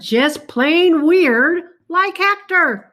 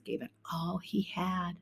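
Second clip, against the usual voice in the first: hushed storyteller's whisper; breathy suspense whisper to a child; 7 semitones lower, 15 dB quieter than usual. A narrator's reading. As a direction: drop to a whisper for the scary bit.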